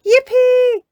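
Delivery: high squealing yippee; cheering. Acted. Peppa cheering about pancakes, squealing high. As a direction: cheer it out with joy. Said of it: "cheered Peppa".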